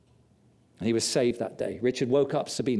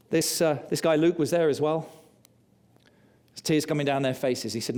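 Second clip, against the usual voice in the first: usual speaking voice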